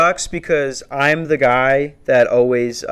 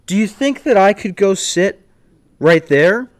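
snide request; 7 semitones higher, 3 dB louder than usual. Retold or imitated. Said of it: "I had to be a dick about it".

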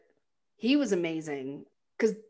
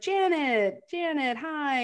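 placating; 8 semitones higher, slower than usual. Retold or imitated. Husband placating angry wife.

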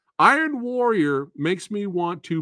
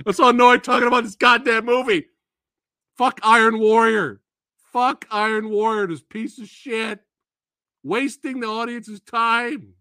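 loud, higher, fast irritated rant; real annoyance; 8 semitones higher, 5 dB louder than usual. Real, not acted. Reviewer ranting loudly at a bad movie, higher and fast. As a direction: vent honest frustration at the movie. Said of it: "like, annoyed now".

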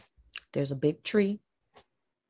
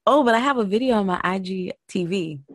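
ordinary delivery

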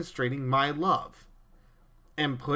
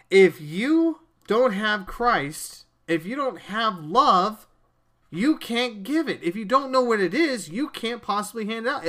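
higher, louder, slowed preaching build; rising sermon fervor, words stretched and pressed; preaching at full force; 5 semitones higher, 4 dB louder than usual. Acted. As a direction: drive the point home like a pulpit climax. Preaching burst to listeners.

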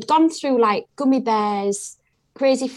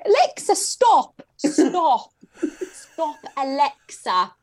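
posh voice; 6 semitones higher, much slower than usual. Shown as a demonstration. Mum telling Alexa to stop the song, in a posh voice. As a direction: put on a posh accent for the smart speaker. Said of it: "only understands me if I say it posh".

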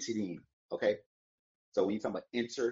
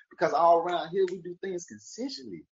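ordinary delivery

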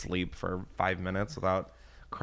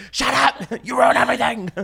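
high, loud yell through laughter; mock yell; 13 semitones higher, 6 dB louder than usual. Yelling at her cohosts who ruin everything, laughing. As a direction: yell it at the room, half laughing. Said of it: "I just always yell at people".

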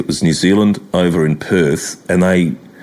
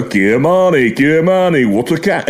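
put-on higher Yorkshire-accented commentary; Fred Trueman impression; 8 semitones higher than usual. Mimicking Trueman praising a West Indian, Yorkshire accent. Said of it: "guys like fucking Fred Truman".

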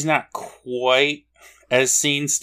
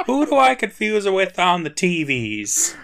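higher, laughing imitation of a spouse calling; mock married couple; 10 semitones higher, 5 dB louder than usual. Cohost mimics the host calling his wife, teasing. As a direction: tease him by copying his call.

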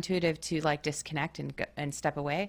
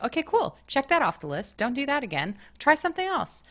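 higher, light and cheery; breezy shrug-off; 9 semitones higher, 3 dB louder than usual. Retold or imitated. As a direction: toss it off with easy cheer.

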